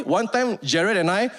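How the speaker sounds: usual speaking voice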